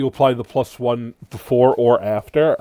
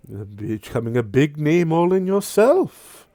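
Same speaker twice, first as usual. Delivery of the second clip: mocking devil. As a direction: sneer at him with relish. Sirik taunts.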